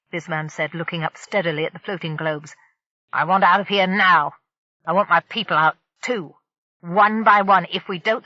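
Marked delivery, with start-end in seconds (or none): 3.1-8.2 threatening. Acted. Pirate threatens hostage.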